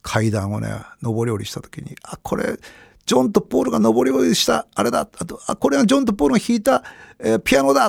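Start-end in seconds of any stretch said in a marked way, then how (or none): 2.0-7.9 high, breathless wonder, half whispered; awed exclamation. Retold or imitated. In the Beatles' studio, awed and high. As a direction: gasp it out like a fan in a holy place. Awe at Abbey Road studio.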